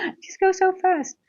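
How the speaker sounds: high-pitched laugh running into the words. Real, not acted. Laughing at how fast rent comes.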